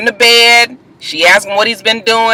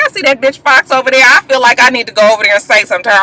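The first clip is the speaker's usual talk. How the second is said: fast eager quote; 2 semitones higher, much faster than usual. Retold or imitated. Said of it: "Jessica was like".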